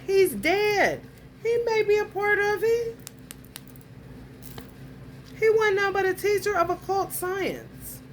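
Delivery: real agitation in a high-pitched voice; heated rant. Real, not acted. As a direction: push the pitch way up, insistent. Heated rant about the ex continues.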